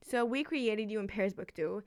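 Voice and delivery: usual speaking voice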